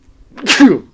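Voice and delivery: loud real sneeze; sudden sneeze bursting out; sneezing. Real, not acted. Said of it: "sorry, I'm gonna sneeze".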